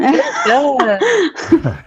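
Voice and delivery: high, loud laughing "Yeah"; mock wedding DJ. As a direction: tease him with a hammed-up DJ voice. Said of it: "You kinda go".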